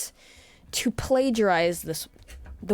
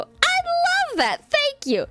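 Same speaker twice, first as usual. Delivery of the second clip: much higher, breathless and gullible; pea-brained eager voice. Retold or imitated. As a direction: gush it like you can't believe your luck. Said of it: "Pea brain over here".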